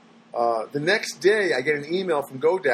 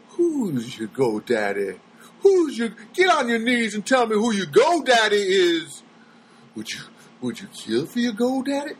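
creepy survey voice; 6 semitones higher than usual. Pretend. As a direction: lean in like a cult leader. Mocking the survey questions.

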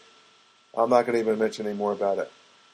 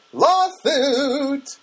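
high, loud camp cry; flamboyant cry; 13 semitones higher, 6 dB louder than usual. Pretend. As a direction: overplay the word Lawsuit.

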